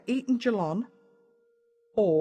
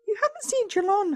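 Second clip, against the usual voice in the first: higher motherly question; Mum's voice; 13 semitones higher than usual. A narrator's reading. Mum asking her son about his friend, higher. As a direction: ask like a mum, pitched up.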